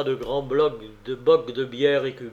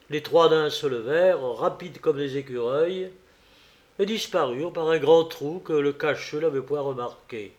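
usual speaking voice

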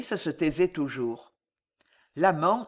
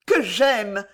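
louder, fierce cry; fierce outburst; 5 semitones higher, 7 dB louder than usual. A narrator's reading. Alice crying out her love to the monk, fierce. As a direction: fling the words at him. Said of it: "s'écria-t-elle dans un éclat farouche".

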